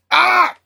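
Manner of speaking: high exasperated yell; frustrated cry. Real, not acted. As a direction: let out a short fed-up cry. Exasperated outburst after rant.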